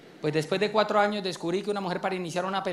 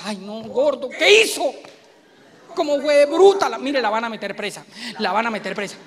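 alarmed wife; 2 semitones higher, 4 dB louder than usual. Acted. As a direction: gasp at the screen like the wife. Esposa alarmada ante la tele. (esposa asustada viendo la pelea en la tele)

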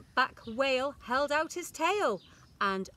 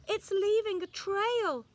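put-on excited children; 10 semitones higher, 3 dB louder than usual. A narrator's reading. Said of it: "say the children".

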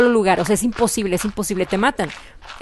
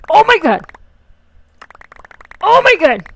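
asombro; 10 semitones higher, 8 dB louder than usual. Real, not acted. Asombro ante recompensa del juego.

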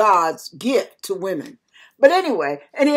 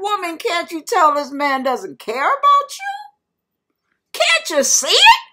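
incredulous exasperation; 6 semitones higher than usual. Retold or imitated. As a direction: plead with her as if she's blind to it. Pleading incredulously with the woman.

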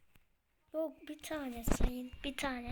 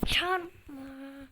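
"çarpma" repeated louder, exasperated; 8 dB louder than usual. Real, not acted. Player repeating the word at the screen, louder and annoyed. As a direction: bark out "çarpma" again with force. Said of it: "Çarpma işte".

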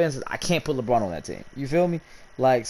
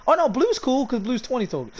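excited hope; 11 semitones higher than usual. Real, not acted. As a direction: blurt it out high and excited. Sudden hope as wheel slows.

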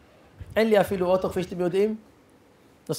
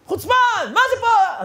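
much higher, louder angry shout; mock yell; 5 dB louder than usual. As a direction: yell at the intruder. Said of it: "מתחיל לצעוק".

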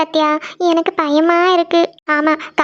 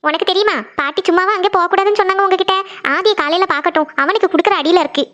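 ordinary talk, nothing marked

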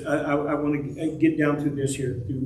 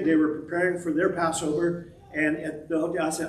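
normal talking voice

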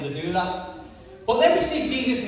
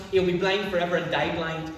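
ordinary delivery

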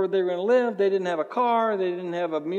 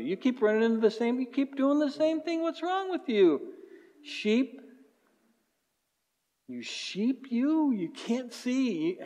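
mock exasperation; 8 semitones higher than usual. Preacher mocking the sheep-like congregation.

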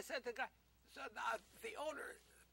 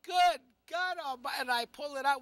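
much louder, higher mock exclamation; loud hammed-up outcry; 4 semitones higher, 13 dB louder than usual. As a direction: belt it out in shocked disbelief. Retold outcry at rusty nail.

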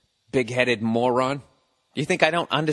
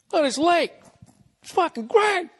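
mock rich tycoon. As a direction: boast like a pompous robber baron. Mocking rich bootlegger admiring lake.

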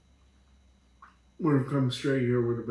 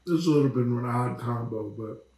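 speaking between bites; talking while chewing; 3 semitones lower, faster than usual. Real, not acted. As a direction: mouth partly full. Seen 0: Eats chips just before; mouth looks full, chewing between words.

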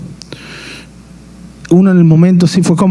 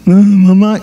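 put-on tearful child; 5 semitones higher, slower than usual. Boy pleading tearfully with grandmother over digging, mock crying. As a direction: imitate your little self crying to grandma. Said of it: "Y yo con lágrimas llorando".

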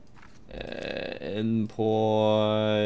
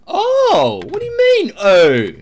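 high, loud mocking shriek; mocking yell; 10 dB louder than usual. Guard mocking a traveller's surprised oh, high shriek. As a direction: throw the traveller's oh back at him.

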